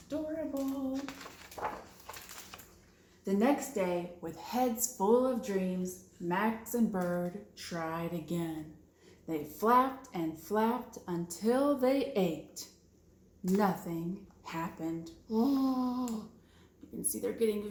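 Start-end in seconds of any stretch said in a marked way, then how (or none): none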